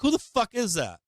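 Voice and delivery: high-pitched incredulous question; retold disbelief. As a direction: re-enact baffled outrage at a mystery culprit. Recounts reacting to mystery big buyer.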